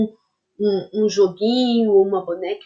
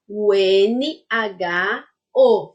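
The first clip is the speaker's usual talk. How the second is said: letters spelled slowly; much slower than usual. Shown as a demonstration. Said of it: "spelling out letters".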